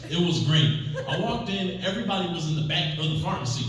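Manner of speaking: ordinary delivery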